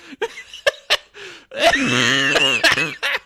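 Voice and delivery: loud, high cackling laugh; real laughing fit. Real, not acted. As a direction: burst out laughing helplessly. Cracking up at the clip.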